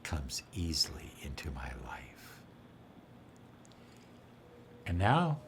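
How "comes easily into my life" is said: low whispered affirmation. Shown as a demonstration. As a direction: whisper it into the water. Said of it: "How about this one?".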